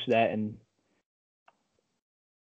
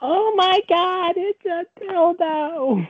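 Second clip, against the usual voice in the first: put-on very high, slow falsetto old lady; crazy old lady; much slower than usual. Prank caller as crazy old lady, high drawn-out wail. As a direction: drag it out in a shrieky old-woman falsetto. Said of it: "That's the voice".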